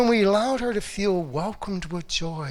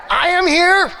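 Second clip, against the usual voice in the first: joyful shout; 12 semitones higher, 10 dB louder than usual. Shown as a demonstration. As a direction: shout "I am here" high and loud. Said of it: "I want you to say".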